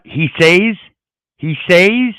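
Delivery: mock-stunned "He says?"; incredulous echo. Real, not acted. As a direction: repeat "He says?" as if you can't believe it. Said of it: "You guys catch that?".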